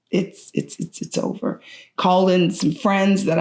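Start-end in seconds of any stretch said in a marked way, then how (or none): 0.1-1.6 voiceless, breath only, faltering repeats; barely a breath, the words falling apart; broken whisper. Real, not acted. Mother finally accepting her son's death, in a broken whisper. Realizing her son is gone.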